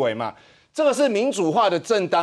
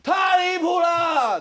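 high, slow, shouted outrage; drawn-out mock outrage shout; Huang Guochang style; 10 semitones higher, much slower than usual. Shown as a demonstration. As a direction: bellow it like an angry legislator. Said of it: "这要用黄国昌的方式".

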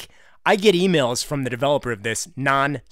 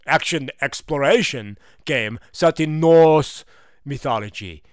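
put-on slow, hammy accent of no known country; grand, drawn-out mystery accent; unidentifiable put-on accent; 5 dB louder than usual. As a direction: declaim it in a muddled foreign accent. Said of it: "I don't even know what this accent is".

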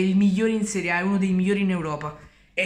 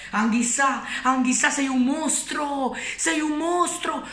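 higher, slow ecstatic cheer; breathless italian goal commentary; 5 semitones higher, slower than usual. Real, not acted. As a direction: hail the player like a goal call. Praising the player ecstatically.

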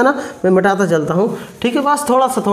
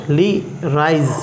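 ordinary talk, nothing marked

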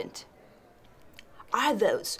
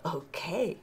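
whisper; 8 semitones lower, 5 dB quieter than usual. Acted. Whispered reaction.

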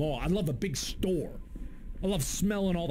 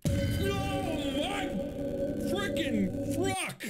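much higher, slowed scared exclamation; startled; much slower than usual. Real, not acted. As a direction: yelp it out in fright. Jump scare.

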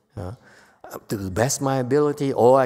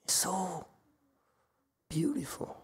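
awed whisper; 5 semitones higher, 12 dB quieter than usual. Real, not acted. Teacher marvels at the teaching.

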